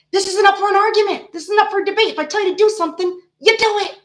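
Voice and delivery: high, loud retold telling-off; scolding mom. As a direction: lay down the law as an exasperated parent, building to the order. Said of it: "I always say to him".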